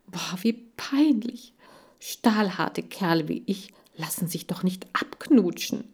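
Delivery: whispered hamster aside; whispered grumble of a proud hamster; embarrassed whisper. Acted. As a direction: mutter it in shame to yourself.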